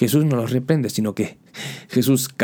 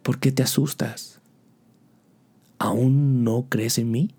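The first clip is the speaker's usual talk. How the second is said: tender voice. Acted. Jesus asking the frightened believer tenderly, with affection. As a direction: ask it gently, like a loving friend. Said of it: "con una voz de cariño".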